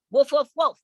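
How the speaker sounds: small dog woofs, high and loud; high yappy bark. Acted. Little dog barks at the family, high and loud. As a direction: yap like a tiny dog.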